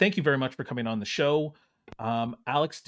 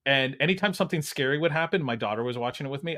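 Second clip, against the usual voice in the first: ordinary delivery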